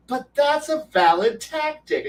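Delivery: high, drawn-out retold objection; whiny protest. Player objecting to game master's veto, high protest. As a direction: protest as if unfairly overruled.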